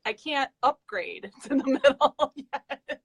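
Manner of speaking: slow, breaking into laughs; laughing through words. Real, not acted. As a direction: giggle between words. Laughing through her own joke.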